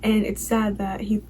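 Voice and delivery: normal talking voice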